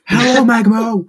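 high, loud, slow greeting called out; calling out hello. Real, not acted. Calling out a greeting to the brother at the door. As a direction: sing it out across the room.

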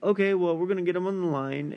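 quick, high imitation of radio hosts; show hosts. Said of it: "they're like".

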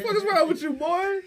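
high, loud exasperated retort; mock scolding. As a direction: squawk it in mock disbelief. Re-enacts scolding the crying caller.

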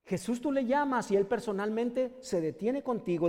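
usual speaking voice

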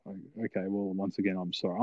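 soft, deflated retold apology; subdued apology. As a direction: back down quietly, acting it out. Retelling his deflated second apology.

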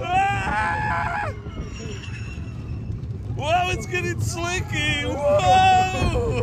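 high, slow thrilled cries; ride-scream delight; excited shouting. Real, not acted. Riding coaster.

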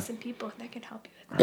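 conspiratorial whisper; breathy secret tip-off. Pretend. Secretive offer of help.

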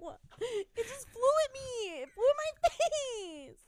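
high, loud horrified wail; screaming in panic. Real, not acted. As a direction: wail in panic, laugh breaking in. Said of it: "you literally scream for your life".

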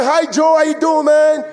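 high loud eager greeting to Joe; friendly invitation. Retold or imitated. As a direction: call out to Joe brightly. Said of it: "I go".

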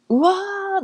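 high, drawn-out wow of dismay; re-enacted stunned realization; acted amazement. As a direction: let うわー stretch out high. Acts out her amazed reaction.

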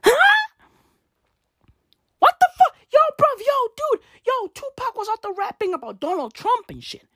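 high, loud, disbelieving squeals; shocked disbelief. Retold or imitated. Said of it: "And I was like".

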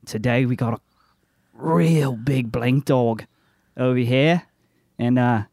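hammed-up, joke Australian accent; bad Australian accent. Monster hunter greets his viewers on camera, bad Australian accent. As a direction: show host putting on a poor Australian accent. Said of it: "don't do a really good Australian accent".

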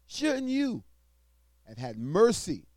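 scripture reading. Acted. Preacher reading Matthew aloud to the congregation, loud and proclaiming.

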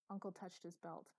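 ordinary delivery, nothing marked

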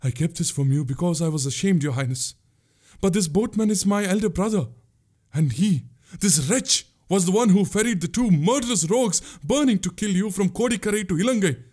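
high, urgent woman's voice; gasping confession. A narrator's reading. Pungurali confessing her brother's guilt to the prince, gasping. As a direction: pour out the shameful news, pitched high. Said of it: "she gasped".

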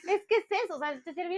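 high voice still laughing through the words. Real, not acted. Co-host still laughing at the story, talking through it. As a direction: speak while the laugh fades.

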